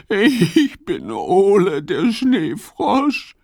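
high, slow, tearful little frog voice; sobbing. Acted. Ole the snow frog tells Fips who he is, sobbing. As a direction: sob out your name like a lost little frog. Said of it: "schluchzt er".

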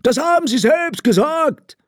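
very high, shrieked accusation; high-pitched insistent yelling; screaming. A narrator's reading. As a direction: cry it out in a high robber voice. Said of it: "schrie der blinde Räuber".

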